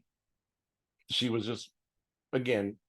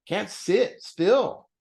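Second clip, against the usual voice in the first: drawn-out insistence; 8 semitones higher, 5 dB louder, much slower than usual. Real, not acted. Urgent life lesson to viewers.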